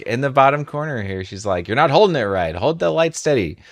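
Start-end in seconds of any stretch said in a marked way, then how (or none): 1.7-3.6 nagging dad. Retold or imitated. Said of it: "just like every dad does to their, their kid".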